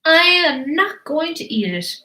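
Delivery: high-pitched, firm little refusal; refusing baby dinosaur. Acted. Baby dinosaur turns down the meat, refusing. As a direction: dig in your heels as a fussy baby. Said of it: "But this baby said".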